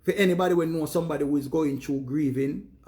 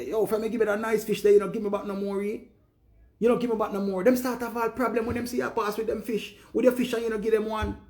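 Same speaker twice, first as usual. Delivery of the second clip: higher, pushy complaining voice; mock demanding neighbour; 6 semitones higher, faster than usual. Neighbour demanding fish from the man, higher pushy voice. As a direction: needle him for not sharing.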